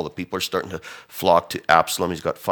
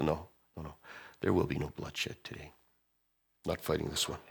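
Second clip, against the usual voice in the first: much softer, settled no; soft refusal; 3 semitones lower, 11 dB quieter than usual. Retold or imitated. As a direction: calmly call it off. David refuses bloodshed softly.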